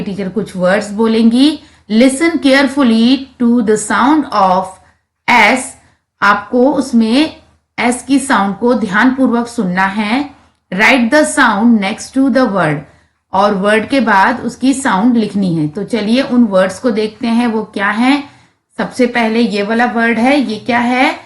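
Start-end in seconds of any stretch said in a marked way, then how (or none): none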